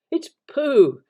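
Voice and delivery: high, loud joyful cry; excited. A narrator's reading. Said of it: "said Christopher Robin excitedly".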